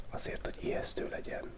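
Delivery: normal talking voice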